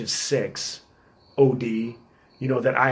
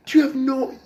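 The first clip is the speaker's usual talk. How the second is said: incredulous scolding; 12 semitones higher, 4 dB louder than usual. Retold or imitated. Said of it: "I'm tearing him up".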